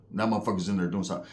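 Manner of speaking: ordinary delivery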